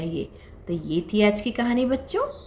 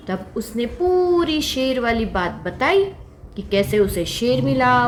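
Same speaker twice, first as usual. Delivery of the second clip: ordinary delivery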